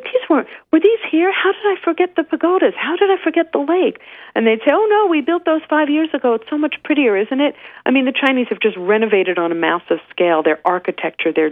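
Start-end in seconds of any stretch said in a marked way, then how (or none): 0.0-4.0 mock bewildered. Asking about the new pagodas. 4.7-7.5 locals replying. Retold or imitated. Locals answering her in the story.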